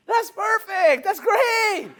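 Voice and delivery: high, upbeat; bright reassurance. Retold or imitated. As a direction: cheer him on. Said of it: "But I'm saying".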